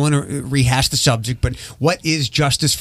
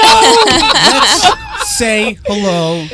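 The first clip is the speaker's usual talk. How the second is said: slow introduction through real laughter; laughing through it; 8 semitones higher, 7 dB louder, much slower than usual. Real, not acted. Host introducing a guest after a joke, still laughing. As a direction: start high and loud, laughing as you introduce.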